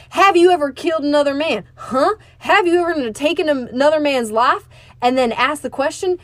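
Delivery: high, loud, building rant ending in a shout; read-aloud veteran's furious dressing-down; furious tirade. Acted. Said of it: "I could feel his body pulsing with anger".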